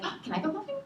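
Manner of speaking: lightly imitated eager kid, high-pitched; high little hopeful question; Abigail. Abigail asks to come along, lightly voiced as high and eager. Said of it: "And then Abigail was like".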